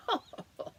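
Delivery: a high burst of laughter; a quick amused dingo laugh. Acted. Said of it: "Dingo laughed".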